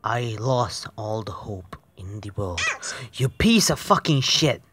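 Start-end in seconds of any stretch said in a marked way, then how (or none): 3.2-4.6 louder, higher raging insult; real angry outburst. Real, not acted. Gamer raging at the game, angry outburst. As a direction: spit it at the screen.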